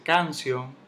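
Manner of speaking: "canción" stressed on CAN; misplaced stress. Shown as a demonstration. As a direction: punch the first syllable of "canción" as a wrong example. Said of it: "no se dice".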